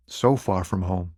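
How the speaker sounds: homesick. Acted. Stranded driver misses his family.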